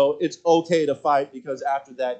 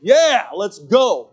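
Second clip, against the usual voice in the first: slow, louder, delighted; eager yes; 5 semitones higher, 7 dB louder, much slower than usual. Retold or imitated. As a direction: draw it out happily. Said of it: "I'm like".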